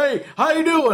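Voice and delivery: high, eager Southern greeting; friendly Southern dad. Retold or imitated. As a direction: play the overfriendly neighbor dad.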